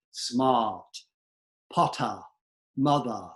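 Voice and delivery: "Smart, potter, mother" with no R, British style; tongue tip down, saying "smaht, pottah, mothah"; dropped R. Shown as a demonstration. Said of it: "you're dropping your R".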